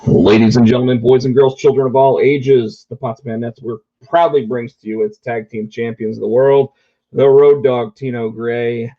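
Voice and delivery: ordinary talk, nothing marked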